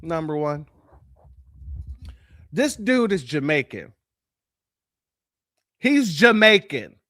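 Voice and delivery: ordinary talk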